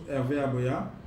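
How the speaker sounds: ordinary talk, nothing marked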